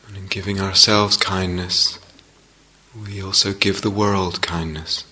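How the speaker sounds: unhurried meditation guidance with long pauses; guided meditation. Real, not acted. Teacher settling meditators into the felt sense of the body, guided meditation. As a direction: ease them gently into practice, leaving space between phrases.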